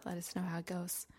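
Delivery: soft breathy whisper. Real, not acted. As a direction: drop to a whisper for the aside. Playful aside to the listener.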